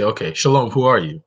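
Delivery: normal talking voice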